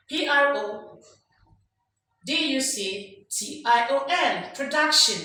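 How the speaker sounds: emphatic classroom spelling of "Production"; rising spelling tone. Shown as a demonstration. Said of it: "the last letter must go rising".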